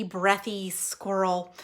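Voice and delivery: ordinary delivery, nothing marked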